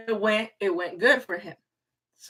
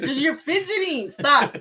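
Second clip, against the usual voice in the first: scolding; 6 semitones higher, 6 dB louder than usual. Real, not acted. Scolding husband fidgeting.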